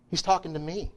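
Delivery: normal talking voice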